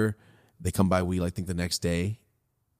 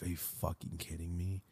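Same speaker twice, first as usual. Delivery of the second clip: much softer, lower muttered disbelief; inner voice; 5 semitones lower, 15 dB quieter than usual. Retold or imitated. His thought when Rosie asks for weed, a soft mutter. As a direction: say it under your breath as a private thought. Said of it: "In my head, I'm saying".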